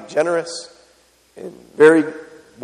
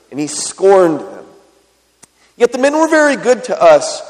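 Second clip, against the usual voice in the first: raised, earnest Bible reading; scripture reading; 3 semitones higher than usual. Acted. Preacher reads the servant warning Abigail, earnest and raised. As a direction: read the servant's plea with urgency.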